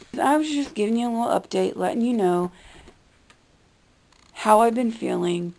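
ordinary talk

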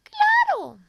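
high chirpy bird voice; little bird voice. Acted. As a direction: squeak it out like a tiny bird. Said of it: "dijo el pajarillo".

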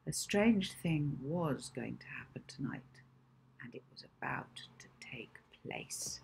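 hushed storyteller fading to a breath; mysterious whisper. A narrator's reading. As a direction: lean in and whisper the secret. Narrator foreshadowing mysteriously.

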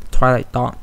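plain reading voice

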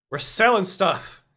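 high, loud hype announcement; excited. Real, not acted. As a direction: pump up the viewers.

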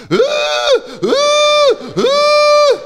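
high loud piercing labour screams. Retold or imitated. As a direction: scream like a woman giving birth. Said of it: "zij was boven in die bevalkamer".

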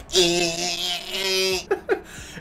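long squeaky little horn noise, then laughing; imitated car horn. Driver laying on his Honda Civic horn, imitated honk. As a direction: mimic a small car's horn held down. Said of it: "laying on my horn".

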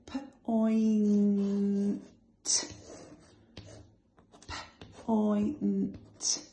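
P-oint split slowly into its sounds; teacher stretches out P-oint; stretched sounding out. Shown as a demonstration. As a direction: drag out P-oint in two parts. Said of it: "So let's sound it out".

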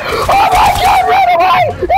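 very high panicked scream; panicked shriek. Real, not acted. Panicked fleeing.

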